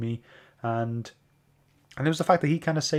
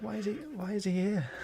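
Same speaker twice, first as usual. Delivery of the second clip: high, puzzled inner question; bewildered at a pointless character, a light high voice; baffled; 9 semitones higher, 5 dB quieter than usual. Retold or imitated. Reader baffled at a character, lightly voiced high puzzlement. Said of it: "I was like".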